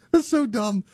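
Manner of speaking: high voice through real laughter; amused laughing. Real, not acted. Host laughing at how dumb it is, amused laughing. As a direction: get it out while still laughing. Laughing at own silly story.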